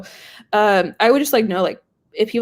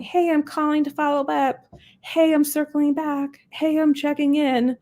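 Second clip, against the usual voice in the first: mock generic rep; 7 semitones higher, slower than usual. Mocking generic rep voicemails.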